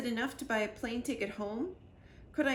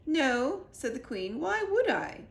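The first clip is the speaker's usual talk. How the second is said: higher, louder queenly voice; puzzled royal reply; 5 semitones higher, 5 dB louder, slower than usual. A narrator's reading.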